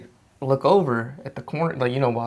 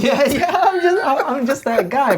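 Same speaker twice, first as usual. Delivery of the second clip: laughing; 7 dB louder than usual. Real, not acted. Laughing at friend's joke.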